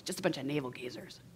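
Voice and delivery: low, quick, dismissive aside; contempt. Acted. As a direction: toss it away softly with contempt. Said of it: "Can you hear the contempt?".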